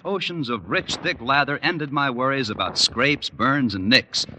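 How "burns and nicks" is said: ordinary talk, nothing marked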